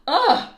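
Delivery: loud, high groan of disgust; loud disgusted ugh. Acted. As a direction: recoil with an ugh. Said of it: "said the innkeeper".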